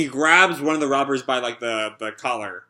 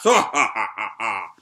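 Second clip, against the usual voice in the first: villain laugh; 5 semitones lower than usual. Retold or imitated. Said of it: "and the boss just laughs".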